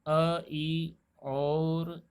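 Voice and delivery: अ and ई held very long; teacher stretching the vowels अ and ई; drawn-out vowels. Shown as a demonstration. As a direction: sound out अ and ई at a crawl. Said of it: "Sanskrit में आई का उच्चारण".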